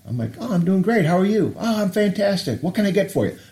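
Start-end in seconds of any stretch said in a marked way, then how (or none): 0.4-1.5 chirpy and pitched up high; match her sunny energy; mirroring the worker's bright tone back at her; cheerful reply. Retold or imitated. 1.6-3.4 bright upbeat hello, pitched well up; imitating a cheerful drive-thru worker greeting a customer; cheery greeting.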